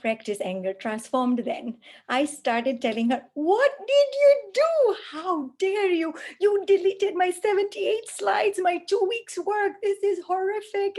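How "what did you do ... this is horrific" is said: high, loud, tearful yelling; furious shouting at daughter. Retold or imitated. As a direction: explode at her over the lost slides, much higher and louder. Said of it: "I'm crying and I'm shouting at her".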